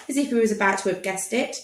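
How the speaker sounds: plain reading voice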